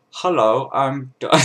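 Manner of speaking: a brief, failed attempt at an accent; Don's accent. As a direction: try an accent and barely get it out. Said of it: "I bet I could do Don's accent".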